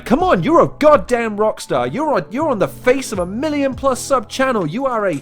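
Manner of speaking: loud, high hype-up rant; excited mock rant. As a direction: gush over him loudly. Teasing rant at guest.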